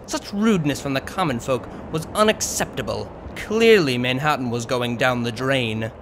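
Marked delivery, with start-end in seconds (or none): none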